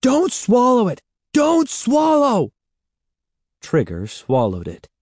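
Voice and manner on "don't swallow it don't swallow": high, loud, slowing shouted pleas; desperate shout. A narrator's reading. Evan shouting at the dog not to swallow. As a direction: beg the dog at full voice. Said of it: "Evan shouted".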